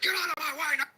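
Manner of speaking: high put-on angry voice; angry push. Angry customer shoves through the line, high mock anger. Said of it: "He's like".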